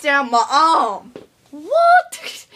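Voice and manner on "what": much higher, incredulous squeal; shocked high what. Acted. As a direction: react in total disbelief. Disbelieving outburst.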